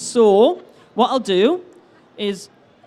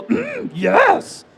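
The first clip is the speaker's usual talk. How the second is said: high-pitched creature hum and yes; Yoda; 10 semitones higher than usual. Shown as a demonstration. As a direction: show off a high, creaky put-on voice. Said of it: "your best Yoda impression".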